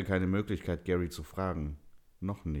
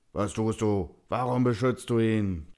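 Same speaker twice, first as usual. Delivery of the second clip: loud, raised growling demand; angry growl; 5 semitones higher, 7 dB louder than usual. A narrator's reading. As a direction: growl it at him, loud and furious. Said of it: "Die Stimme war nur noch ein Knurren".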